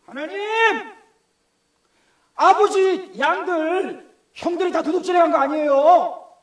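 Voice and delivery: high, loud, slow aggrieved plea; Joseph complaining. Acted. Joseph pleading to God about his brothers, high and slow. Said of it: "처음에 원망했겠어요".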